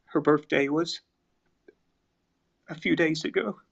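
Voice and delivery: fighting back tears while talking about his sister; getting emotional. Real, not acted. Telling the stream about his late sister, choked up. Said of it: "I'm getting a little emotional".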